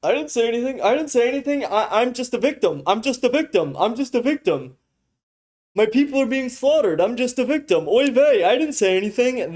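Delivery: a caricature of him pleading he said nothing; mock Stephen Miller. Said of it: "he is like".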